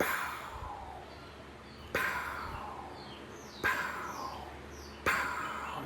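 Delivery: soft, voiceless "pow" sounds with long gaps; imitated distant gunshots. Hunter mimicking shots heard from the hill, hushed echoing pows. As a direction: puff out faraway rifle cracks.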